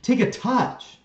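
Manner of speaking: high, loud plea at a player; exasperated yell. Real, not acted. Fan telling a player what he should have done, exasperated yell. Urging player after missed chance.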